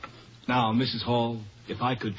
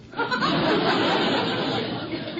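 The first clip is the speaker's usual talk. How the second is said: breathy laugh. Real, not acted. Photographer leaving, amused.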